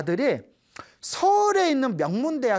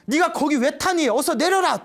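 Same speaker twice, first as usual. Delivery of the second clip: higher, louder urgent call; mother's loud command; 8 semitones higher, 6 dB louder than usual. Retold or imitated. Mother ordering her son off the truck, urgent loud call. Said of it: "또 엄마 목소리가 들리더래요".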